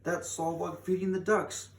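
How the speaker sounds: a frantic father crying out; father shouting. A narrator's reading. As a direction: call out as if spotting her in the painting. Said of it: "her father shouted".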